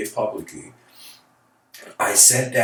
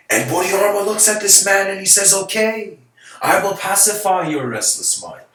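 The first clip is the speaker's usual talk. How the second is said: rising excitement; 7 semitones higher, 4 dB louder than usual. Acted. Storyteller building to master's reply.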